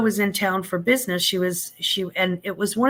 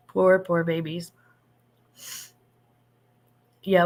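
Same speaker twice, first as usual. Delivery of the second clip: ordinary delivery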